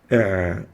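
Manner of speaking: a squeezed ع from deep in the throat; like someone choking you; صوت العين الحلقي; choking throat sound. Shown as a demonstration. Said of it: "is a throat letter".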